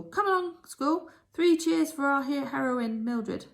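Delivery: high, loud call for cheers; rally the school; headmistress. A narrator's reading. Headmistress calling three cheers for Mildred: high loud rallying voice.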